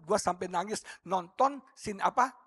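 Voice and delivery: ordinary talk, nothing marked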